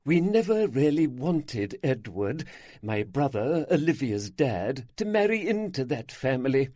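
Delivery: ordinary delivery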